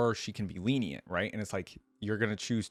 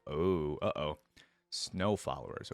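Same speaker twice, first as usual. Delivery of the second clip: usual speaking voice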